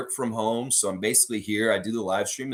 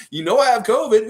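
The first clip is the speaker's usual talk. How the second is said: louder, higher imitated objection; acted-out protest; 10 semitones higher, 9 dB louder than usual. Tenant to hugging landlord, imitated loud protest. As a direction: protest loudly as if re-enacting it.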